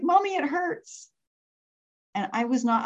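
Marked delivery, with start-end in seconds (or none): 0.0-1.1 put-on high, drawn-out child's whimper; mock child crying. Hurt child calling to her mom, imitated tearful whine. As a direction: imitate a hurt little girl's plaint. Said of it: "she's crying".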